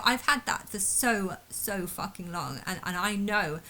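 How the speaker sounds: ordinary talk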